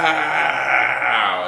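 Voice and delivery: loud, high mock punch noise; punch sound effect. Dad socks his son, loud punch noise. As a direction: shout out the impact of the punch.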